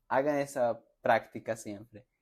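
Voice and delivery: ordinary delivery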